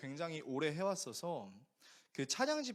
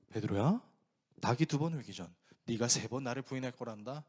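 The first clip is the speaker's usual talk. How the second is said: lower, firm, knowing reply; gentle Jesus; 5 semitones lower, 5 dB louder than usual. Acted. Jesus answering boastful Peter, low and firm. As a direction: tell him calmly what will happen.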